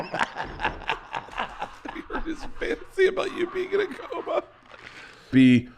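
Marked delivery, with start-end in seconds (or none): 0.1-4.4 high, squeaky laughing wheeze; words squeezed out through tears of laughter; crying with laughter. Real, not acted. Repeating line, crying with laughter.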